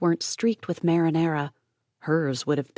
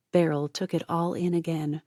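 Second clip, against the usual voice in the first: ordinary delivery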